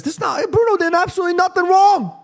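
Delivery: high-pitched, exasperated defense; indignant protest. Real, not acted. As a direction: protest in disbelief, voice climbing high. Indignantly defending a blamed player.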